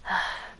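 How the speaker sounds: soft breathy sigh; weary sigh. Acted. Club leader unsure what to do, weary sigh. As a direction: let out a quiet, defeated breath. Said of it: "Monika sighs".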